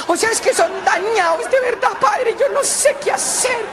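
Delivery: high, whining complaint; exasperated mother. Acted. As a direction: moan to the priest in despair.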